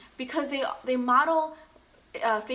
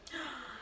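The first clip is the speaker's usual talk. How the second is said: playful gasp; 12 dB quieter than usual. Real, not acted. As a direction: gasp in mock surprise.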